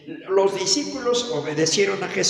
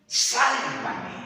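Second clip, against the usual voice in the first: higher-pitched retold cry for help; raised, dramatised call for rescue; Peter's plea; 5 semitones higher than usual. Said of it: "qué le dice Pedro al maestro".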